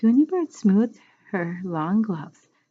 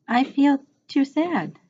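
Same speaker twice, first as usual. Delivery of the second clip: whimper; 8 semitones higher, 3 dB louder, slower than usual. A narrator's reading. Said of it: "she whimpered".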